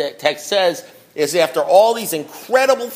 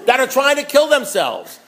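fired-up preaching; 6 semitones higher than usual. Real, not acted. Scolding the room of sponsors, impassioned and raised. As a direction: preach it like your life depends on it.

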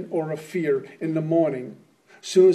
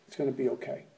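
reassuring inner voice; 4 semitones lower, 11 dB quieter, faster than usual. Retold or imitated.

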